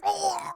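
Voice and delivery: sharp high gasp of pain. Acted. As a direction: gasp as the blade hits. Guard stabbed through.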